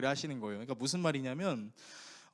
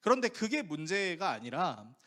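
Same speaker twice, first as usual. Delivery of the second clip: usual speaking voice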